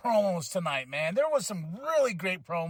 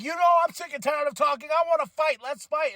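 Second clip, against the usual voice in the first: mock angry rant; 7 semitones higher than usual. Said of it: "Kevin Owens goes off".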